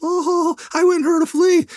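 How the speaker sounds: high, prim put-on innocence; innocent witness voice. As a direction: play the sweet church girl on the stand. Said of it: "little Sunday school outfit".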